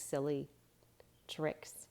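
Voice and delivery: whispered aside, fading out. A narrator's reading. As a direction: share it like a secret. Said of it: "whispered aside".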